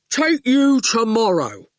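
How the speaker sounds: slow, terse thug; slow goon voice. Acted. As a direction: plod out each word. The goon's terse voice.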